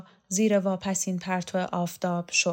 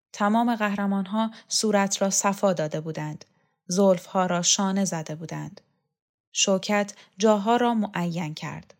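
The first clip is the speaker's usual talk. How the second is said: ordinary delivery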